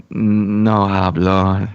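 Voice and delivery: very slow, drawn-out "No hablo"; cashier's reply. Retold or imitated. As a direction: stretch it out, deadpan cashier. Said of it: "she was like".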